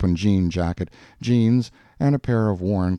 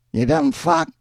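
very high put-on jeer; parting insult. A narrator's reading. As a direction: toss the insult back in a high voice. Hank turns and adds insult.

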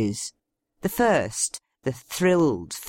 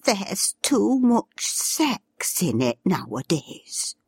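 weak voice; 5 semitones higher, slower than usual. A narrator's reading. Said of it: "A weak voice piped up".

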